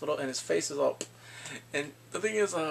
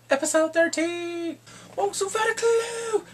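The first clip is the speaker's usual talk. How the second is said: high, loud, stretched-out squeal; excited announcement; 6 dB louder, slower than usual. Real, not acted. Announcing the next episode to viewers, excited squeal. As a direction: proclaim it slowly in a high voice.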